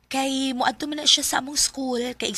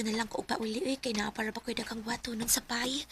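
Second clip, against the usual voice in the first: whispered; 3 semitones lower, 8 dB quieter than usual. Acted. Hurried excuse to leave.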